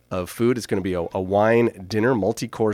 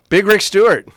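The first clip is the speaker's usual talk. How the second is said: announcer call; 9 semitones higher, 7 dB louder than usual. Acted. Announcing guest again.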